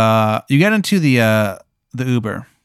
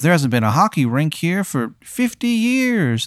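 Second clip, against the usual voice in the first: put-on higher-pitched old man voice; 4 semitones higher than usual. Crazy old man answering about the hockey rink, old man voice. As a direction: play the old man, drawing out the last word. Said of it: "this old man, he goes".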